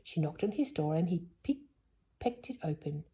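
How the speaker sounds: plain reading voice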